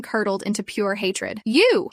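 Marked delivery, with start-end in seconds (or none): 1.4-1.9 loud, high hateful bark; spat out. Acted. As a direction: spit the word at her. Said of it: "he spat".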